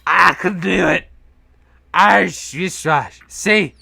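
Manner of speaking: put-on loud, high drunk Irish accent; drunken Irishman. Imagined Irishman insisting he can, drunken Irish accent. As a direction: bellow it like a boozy Irishman proving himself. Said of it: "a drunken Irishman being like".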